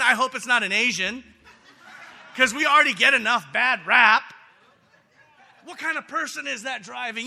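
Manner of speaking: loud, laughing through the words; laughing while talking. Real, not acted. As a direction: laugh at your own joke as you shout it. Laughs at his own rant.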